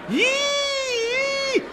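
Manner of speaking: long high "eee" squeal; drawn-out, piercing wail; engine-like scream. Acted. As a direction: scream like a siren. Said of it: "began screaming like some kind of engine".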